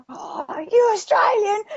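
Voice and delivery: high, surprised imitation of the Aussie co-star. Co-star imitated discovering she is Australian, surprised. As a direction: play him delighted to find an Australian. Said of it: "but he's like".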